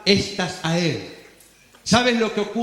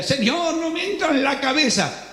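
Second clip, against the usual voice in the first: higher, quick anxious plea; confused believer; 4 semitones higher than usual. Acted. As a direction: fret aloud to the Lord. Believer pleads to God.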